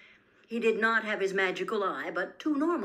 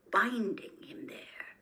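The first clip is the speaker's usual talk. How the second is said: usual speaking voice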